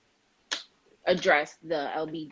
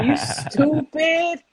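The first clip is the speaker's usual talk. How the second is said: slow words through laughter; co-host cracking up at a joke; laughing; 10 semitones higher, 3 dB louder, much slower than usual. Real, not acted. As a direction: laugh it out at your friend.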